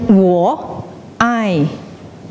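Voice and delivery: slow, word by word with pauses; weighty, responsible. Acted. Saying it in Chinese means responsibility, slow and deliberate. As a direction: lay each word down slowly. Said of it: "like you have to be responsible".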